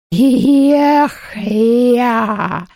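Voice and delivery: high exultant ghost cry; gleeful. Acted. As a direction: crow with glee. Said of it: "ликовало привидение".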